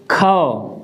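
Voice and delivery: खौ said as aspirated "khau"; teacher sounding out "खौ"; kha with au matra. Shown as a demonstration. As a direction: model "खौ" clearly. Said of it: "अगर ख पे लगा दी तो".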